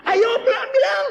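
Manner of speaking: very high, loud laughing urging; urging shout. Acted. Preacher urges the silent villagers loudly. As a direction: push them to say it.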